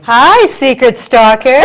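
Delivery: loud, slow playful greeting; amused teasing. Real, not acted. Co-host teasing the guest who lurked unseen, amused. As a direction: draw out a gleeful hello. Teases guest just discovered.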